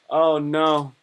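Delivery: ordinary talk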